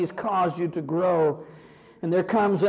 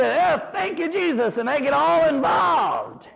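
fervent preaching; 8 semitones higher than usual. Acted. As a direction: preach it with mounting joy. Preaching builds up.

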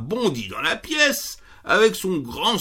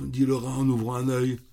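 usual speaking voice

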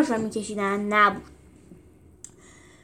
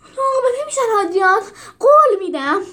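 much higher, louder anxious promise; nervous reassurance; 12 semitones higher, 8 dB louder than usual. Acted. Teacher nervously assuring Hadrian, high and anxious. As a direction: plead nervously to calm the emperor. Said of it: "استاد به او اطمینان داد".

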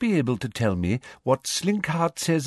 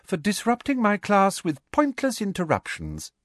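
smooth; 11 semitones higher than usual. A narrator's reading. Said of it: "said Professor Umbridge smoothly".

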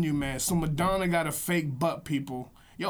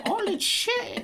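high, startled exclaim; mock shock; slower than usual. Real, not acted. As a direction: yelp in fake shock.

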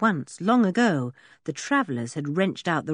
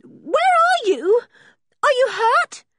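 very high, louder, drawn-out call; anguished girl calling for her dog; crying out; 6 dB louder, slower than usual. A narrator's reading. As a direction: call into the distance in panic. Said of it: "she cried".

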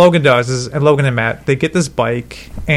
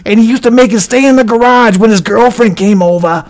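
smoker's rasp; 8 semitones higher than usual. Retold or imitated. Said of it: "he talked just like that old-ass ninety-two-year-old man".

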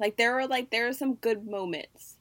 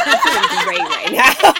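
laughing through the words; giggling while bragging; 16 dB louder than usual. Real, not acted.

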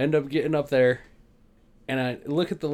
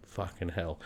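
muttered curse; 8 semitones lower, 12 dB quieter, slower than usual. Retold or imitated. Cursing quietly while everyone stares. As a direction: lower and much softer. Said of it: "and I'm like".

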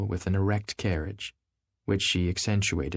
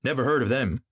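decisive; 5 semitones higher, 3 dB louder than usual. Acted. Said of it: "he remarked decisively".

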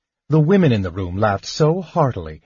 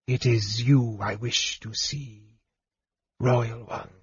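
much softer, slow hushed voice; whispered; 7 dB quieter, slower than usual. Acted. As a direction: hush it like a secret. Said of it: "whispered a Draconian".

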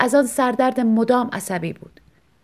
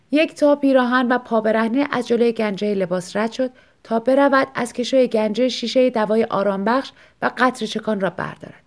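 ordinary delivery, nothing marked

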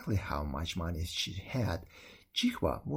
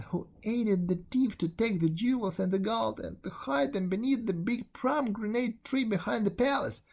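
put-on higher, tearful young girl confessing; a frightened girl pouring out her guilt; pleading servant girl; 6 semitones higher than usual.

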